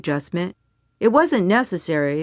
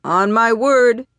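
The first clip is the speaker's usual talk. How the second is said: slow, loud, higher command call; projecting; 5 semitones higher, 6 dB louder, slower than usual. A narrator's reading. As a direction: project it across a big room. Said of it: "Colonel Taylor projected across the command center".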